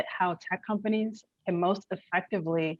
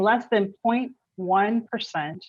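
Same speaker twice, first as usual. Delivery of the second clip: less than point one percent, slowly with firm stress; slow, emphatic repeat; 3 semitones higher, 3 dB louder, slower than usual. Real, not acted. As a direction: let each word of "less than point one percent" land separately. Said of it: "say it slow and say it again".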